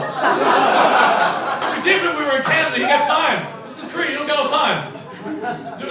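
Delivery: hammed-up parent barking at a six-year-old; a pushy parent hurrying a child to work; mock pushy Korean parent.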